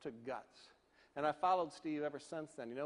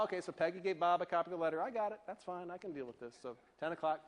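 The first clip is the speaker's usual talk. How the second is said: normal talking voice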